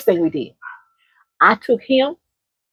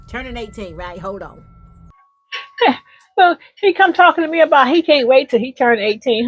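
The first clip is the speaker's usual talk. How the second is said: usual speaking voice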